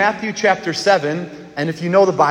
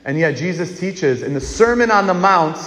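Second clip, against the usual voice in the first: normal talking voice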